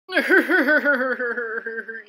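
put-on villain's cackle; evil cackle. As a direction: cackle wickedly, long and rippling. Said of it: "evil, evil cackle sound".

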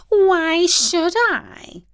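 high, slow drawn-out whine; whining cat. A narrator's reading. Hungry cat whining to the dog, high and slow. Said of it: "whined the cat".

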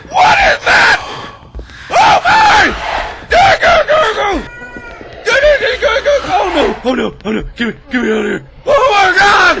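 very high, loud frantic shrieks; panicked screaming. Real, not acted. Player begging to get out of here, panicked screaming. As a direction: shriek the words in terror. Player fleeing in horror game.

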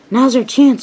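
high, loud rallying call; acted troublemakers' cheer; scheming eagerness. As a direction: seize the chance, loud and high. Trucks seize their chance.

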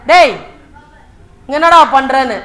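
loud, flustered challenge; acted agitated demand. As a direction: call him out in agitation. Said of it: "பதட்டம் ஆயிட்டு கேட்டாரு".

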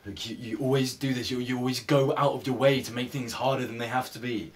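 building from annoyed to loud, exasperated; frustrated outburst. Acted. Breakup argument, frustration boiling over.

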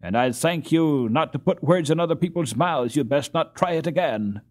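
loud, high, savage warning to a rival; savage retort. A narrator's reading. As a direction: snap back at Peter with real menace, loud. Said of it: "Karl wheeled about savagely".

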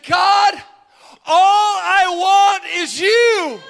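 high, loud, drawn-out shout to God; desperate cry. Retold or imitated. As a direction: cry out desperately, stretching every word. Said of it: "in my desperate cry, I shouted".

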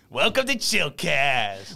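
slow, lightly put-on host greeting. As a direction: draw it out like a mock celebrity shout-out. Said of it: "From [name] saying".

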